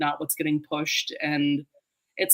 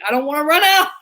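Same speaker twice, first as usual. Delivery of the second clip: higher, louder fretful inner voice; mock panic; 9 semitones higher, 9 dB louder, faster than usual. Explaining why she hoards a series, mock panic. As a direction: playfully fret about running out. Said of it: "I'm like".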